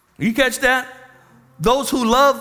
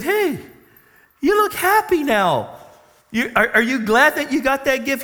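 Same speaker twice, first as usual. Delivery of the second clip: much higher, bright mock cheer; teasing passersby; 8 semitones higher than usual. Passersby calling to the man on his ten speed, high and teasing.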